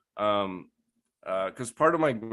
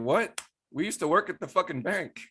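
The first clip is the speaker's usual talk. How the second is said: incredulous; 8 semitones higher than usual. Retold or imitated. Reacting to an old bank coworker as owner, incredulous. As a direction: blurt it out in disbelief.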